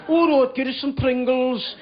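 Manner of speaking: slow imitated Birmingham; Birmingham accent. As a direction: give in slowly in a Birmingham accent. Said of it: "in his Birmingham accent".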